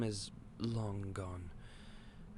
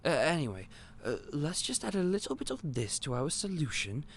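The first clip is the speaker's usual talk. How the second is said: normal talking voice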